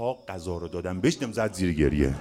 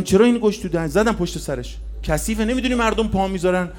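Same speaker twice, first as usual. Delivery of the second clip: raised, mildly agitated scolding; retold scolding; 12 semitones higher, 10 dB louder than usual. Father telling child off for dirty food, retold scolding. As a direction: act out a father's urgent reproach.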